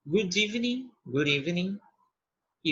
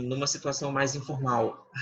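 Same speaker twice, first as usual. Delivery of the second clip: ordinary talk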